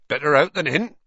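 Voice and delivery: big cheerful reassurance. A narrator's reading. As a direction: call it out cheerfully. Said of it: "he said cheerfully".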